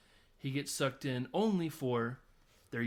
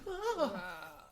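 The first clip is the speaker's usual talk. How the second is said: high short shriek; mock scream; 8 semitones higher than usual. Mom opening the door on a blood-soaked room, high shriek. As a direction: yelp in horror. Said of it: "his poor old mom to ... just be like".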